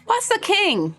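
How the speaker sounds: put-on high, slow child voice; chirpy young child introducing themself; little kid voice. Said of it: "little tow-headed kid".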